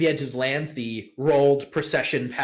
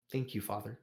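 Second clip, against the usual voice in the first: soft tearful thanks; 2 semitones lower, 10 dB quieter, faster than usual. Acted. GM voices tearful son to father.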